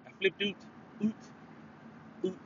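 put-on quiet Canadian accent on "out". Imitating how a Canadian rapper talks, Canadian accent. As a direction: play with a Canadian "out". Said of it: "like a [name] would say".

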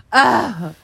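loud mock "ah" moan. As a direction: let out a hammed-up moan. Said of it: "I'm more like".

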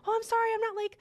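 imitating the client's high flustered apology; flustered apologizing client. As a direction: play the nervous guy saying sorry.

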